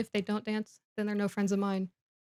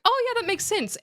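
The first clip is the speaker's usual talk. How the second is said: eager agreement; 12 semitones higher than usual. Retold or imitated. Harry eagerly accepting Ron's plan.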